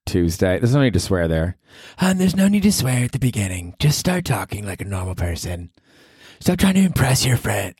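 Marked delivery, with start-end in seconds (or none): 2.0-7.8 put-on girlfriend voice. Girlfriend voiced scolding him.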